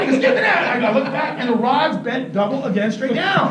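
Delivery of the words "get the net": very high, louder urgent yell; imitated frantic fisherman's cry; insistent calling out. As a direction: shout it back over a doubting friend. Repeats the call after why.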